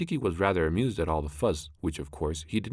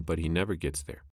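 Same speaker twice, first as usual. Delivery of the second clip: ordinary delivery, nothing marked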